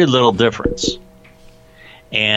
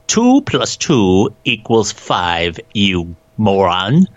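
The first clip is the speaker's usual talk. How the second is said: slow, deliberate mock-British delivery; British accent; much slower than usual. Shown as a demonstration. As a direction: put on a British accent, stretching out each word. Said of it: "say anything with a British accent".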